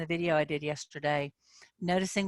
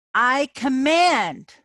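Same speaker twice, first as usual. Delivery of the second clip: acted frustration; 6 semitones higher, 8 dB louder, much slower than usual. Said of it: "I'm acting".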